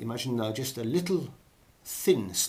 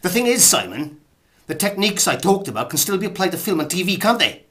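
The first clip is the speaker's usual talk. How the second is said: high, loud objection to Simon; raised, indignant-sounding voice; loud protest; 9 semitones higher, 11 dB louder than usual. Acted. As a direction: argue back at full volume. Loud retort to Simon's email.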